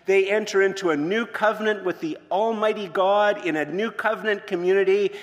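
loud, high-pitched preaching at full force; predicación enérgica. Real, not acted. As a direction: proclaim it to the whole congregation, loud and high.

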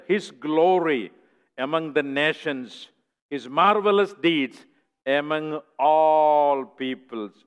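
slow, loud solemn reading; a touch of pulpit reading; scripture reading. Acted. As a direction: proclaim the verse slowly. Reads scripture verse.

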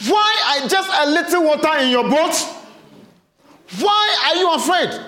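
half-laughing exasperation; mildly upset Jesus. Acted. Jesus almost upset with the disciples, high and exasperated. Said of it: "Jesus was almost upset with them".

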